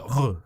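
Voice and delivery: 'R' as a throaty 'ruh' sound; French back R. Shown as a demonstration. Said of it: "the R at the back".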